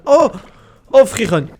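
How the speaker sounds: sudden loud high exclamation; startled yell of surprise; surprised shout. Real, not acted. Shouts on seeing something in game.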